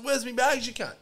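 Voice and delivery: guy demanding his missing bags; retold angry demand. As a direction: bark the demand at the intruder. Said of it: "I said".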